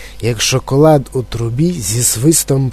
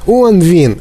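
higher, louder cry of discovery; excited shout; 5 semitones higher, 5 dB louder than usual. A narrator's reading. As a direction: shout out that you have spotted him. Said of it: "крикнув хтось". (збуджений вигук)

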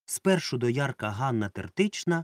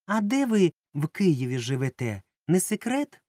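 coquettish high teasing question; flirty Klava; 6 semitones higher, 3 dB louder than usual. A narrator's reading. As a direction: flirt with the tourist, playful and curious. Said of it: "кокетувала страшенно".

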